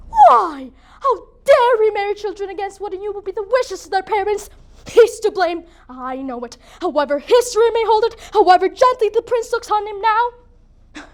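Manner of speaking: high, loud dramatic accusation; indignant outburst. Acted. Mother blames the friar for marrying children, indignant. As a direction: flare up in outrage at the betrayal.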